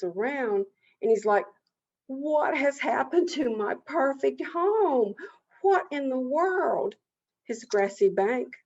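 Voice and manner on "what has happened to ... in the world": higher, drawn-out cry of disbelief; aghast turtle, stretching the words in dismay; appalled, high and slow; shocked dismay. A narrator's reading. Turtle finds his home changed.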